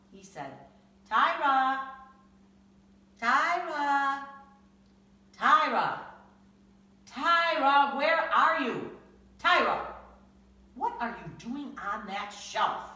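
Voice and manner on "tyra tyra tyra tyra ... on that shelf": loud, high calling across the store. Acted. Father searching the store for Tyra, loud calling. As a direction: call out looking for a lost child.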